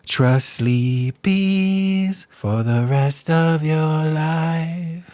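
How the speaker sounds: jingle announcer. Pretend. Parody mattress ad jingle.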